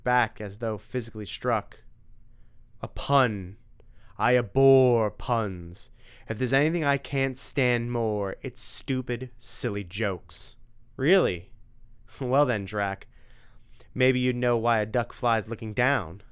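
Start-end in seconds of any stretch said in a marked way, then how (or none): 2.8-10.6 slow, louder vampire loathing; disgusted vampire. Acted. Dracula disgusted by puns. 11.0-16.2 teasing. Acted. Tim teasing Dracula.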